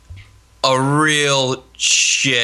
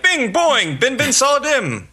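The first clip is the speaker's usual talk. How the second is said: high bouncy sound effects; cartoon sound effects; 10 semitones higher, slower than usual. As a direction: chirp the sounds out high and playful. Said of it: "Mine would be like".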